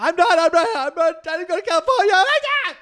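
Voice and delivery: fast, high-pitched frantic denial; panicked caller screaming over you; imitated screaming. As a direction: babble it in a rising mock-hysterical shriek. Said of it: "And she's screaming".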